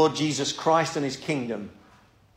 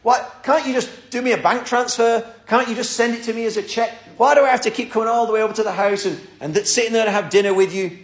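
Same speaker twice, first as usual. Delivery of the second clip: higher, complaining voice of an impatient son; frustrated son; 6 semitones higher, 3 dB louder than usual. Retold or imitated. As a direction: whine like a young man who wants to be left alone. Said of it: "the son's getting a bit frustrated and says".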